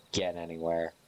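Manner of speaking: ordinary delivery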